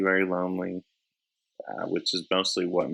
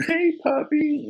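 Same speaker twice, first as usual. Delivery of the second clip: put-on pet baby talk; 3 dB louder, slower than usual. Talking to a dog.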